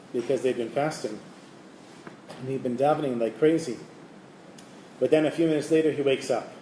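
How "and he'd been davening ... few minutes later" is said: ordinary delivery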